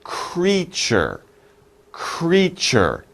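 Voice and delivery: Creature said clearly as KREE-chur, two syllables; model pronunciation. Shown as a demonstration. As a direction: model Creature slowly for students to repeat. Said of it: "Let's practice the pronunciation".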